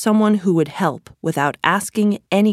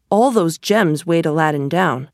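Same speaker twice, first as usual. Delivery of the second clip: usual speaking voice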